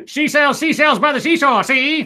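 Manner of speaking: high-pitched, hammed-up Chicago accent with a laugh. Shown as a demonstration. Host reading a tongue twister on viewer request, Chicago voice. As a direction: belt it out in a silly, high Chicago voice. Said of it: "in your Chicago voice".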